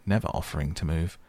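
ordinary delivery, nothing marked

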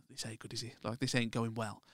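softly muttered retold worry; under the breath, as if at the back of the room; hushed retold muttering; quiet aside. Watching struggling set from back.

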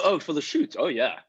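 ordinary talk

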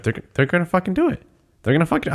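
quick, high, thrilled disbelief; rising excitement. Retold or imitated. Telling a friend about Cad Bane, excited and quick. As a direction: speed up as the hype hits. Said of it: "I just went".

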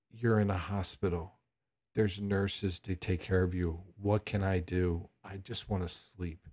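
quiet, slow, half-asleep irritation; softly exasperated daughter on the late-night phone; sleepy annoyance. Retold or imitated. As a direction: mutter it back, woken at two and fed up. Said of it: "In fact, I was angry".

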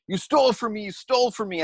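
high-pitched accusing mock yell; retold yell. Victim yelling on thief's back, retold high yell. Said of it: "yelling at this man".

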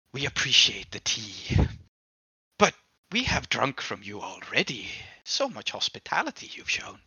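soft, eerie demon thanks with a fixed grin; changed demon voice. Acted. Demon thanking the host through a wide grin, eerie and soft. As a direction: sound unsettlingly grateful. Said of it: "his voice changes".

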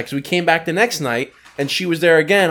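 ordinary talk

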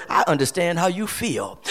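low, very soft, understanding voice; gentle reassurance. Acted. Preacher voicing Jesus on the throne of grace, gentle reassurance. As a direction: drop down soft to comfort them.

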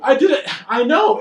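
high, loud laughing admission; loud sheepish confession; defensive shout. Real, not acted. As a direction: own the blunder with a loud laughing cry. Teased about running wrong way.

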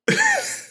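high squeaky dolphin squeal; dolphin noise. Pretend. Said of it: "you'll love this".

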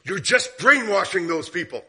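woman in the bank accusing the preacher, building anger; accusing lady. Retold or imitated. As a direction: hurl it at him like a charge. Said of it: "She goes".

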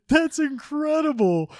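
high, slow, delighted amazement; acted-out gleeful disbelief; imitated amazed friend. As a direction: draw it out in high-pitched amazement. Friend's amused reaction retold.

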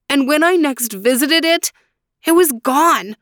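a man who lost his life savings, rising in dismay; grieving Faraz. Acted. Faraz tells of lost gold.

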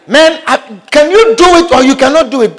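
an interviewer losing patience, raising his voice; exasperated interviewer. Acted. Impatient interviewer demands an answer.

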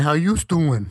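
playful Brooklyn "How you doing?" greeting; Brooklyn accent. Pretend. Greeting cohost Antonio in a mock Brooklyn accent. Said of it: "I wish I had that Brooklyn accent".

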